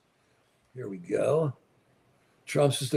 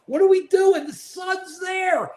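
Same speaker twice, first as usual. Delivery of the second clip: high-pitched exasperated cry; worked-up ranting yell; exasperated outburst; 12 semitones higher, 4 dB louder, slower than usual. Real, not acted. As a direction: cry out in exasperation, dragging out the last words. Exasperated rant about wasted solar power.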